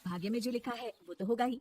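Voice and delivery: ordinary delivery, nothing marked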